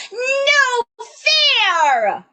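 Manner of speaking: high, loud mock tantrum yell; scrunched toddler yell. Shown as a demonstration. Teacher plays toddler whose toy was taken, belted yell. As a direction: yell like a mad three-year-old. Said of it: "We're yelling at them".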